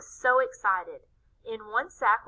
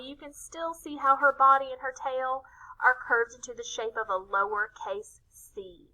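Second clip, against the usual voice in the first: usual speaking voice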